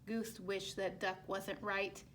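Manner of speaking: plain reading voice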